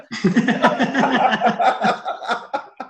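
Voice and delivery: a long, high, loud burst of laughter; big real laugh. Real, not acted. Remembering Neil's interview, a big real laugh. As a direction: lose it completely, laughing hard. Laughing at his own story.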